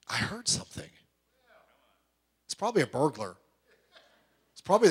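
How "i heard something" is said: spooky hushed whisper; mock whisper. Churchgoer telling of a night voice, hushed mock whisper. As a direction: breathe it like a ghost story. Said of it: "People are like".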